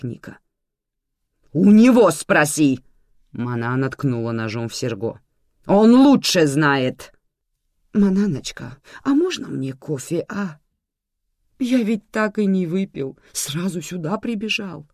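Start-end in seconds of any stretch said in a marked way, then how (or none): 1.5-2.8 loud, drawn-out, higher jab; irritated retort. A narrator's reading. Manana passes Nika's question on to Sergo, irritated. 5.7-7.1 loud, slow, pointed accusation; irritated retort. A narrator's reading. Manana points the blame at Sergo, irritated. 7.9-14.9 higher, gentle wheedling; tender coaxing. A narrator's reading. Sergo coaxes Manana for a coffee, tenderly. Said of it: "начал нежно говорить Серго".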